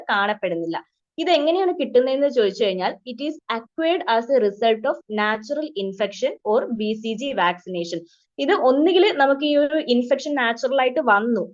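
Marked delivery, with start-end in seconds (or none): 3.1-8.0 very slow, word-by-word dictation of a definition. Shown as a demonstration. Teacher dictating a definition to nursing students, slow and even. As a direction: read it out slowly so students can write. Reads textbook definition for notes.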